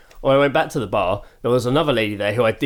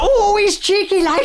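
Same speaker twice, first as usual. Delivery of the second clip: very high, stretched mock Northern squeal; hammed-up Northern accent, like an advert voice-over; cheeky Northern girl; 3 dB louder, slower than usual. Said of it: "I sound like the guy who does".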